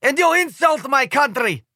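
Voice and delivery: put-on high, accented, stressing you; Slovenian cry. A narrator's reading. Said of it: "cries the Slovenian guy".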